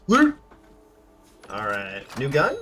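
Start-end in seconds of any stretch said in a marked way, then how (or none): 0.1-0.4 high, loud gleeful cry; excited shout. Real, not acted. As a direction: cheer over the new loot. Finding loot.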